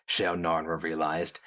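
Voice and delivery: plain reading voice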